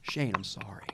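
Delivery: soft, breathy apology under the breath; whispered apology. Acted. Apologizing quietly to Shane in a hushed whisper. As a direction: whisper it like a secret sorry.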